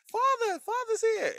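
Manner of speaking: very high, sing-song mock herald call; mock heraldic announcement. Mocking the movie hero's homecoming in a high herald voice. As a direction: announce the father like a pompous herald. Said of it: "doing that".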